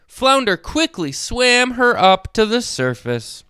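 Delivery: ordinary delivery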